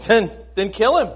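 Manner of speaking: high, loud, insistent egging on; loud, high-pitched scheming advice; urging friends. Acted. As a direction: push him to act as if it were obvious. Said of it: "his wife Zeresh and all his friends said".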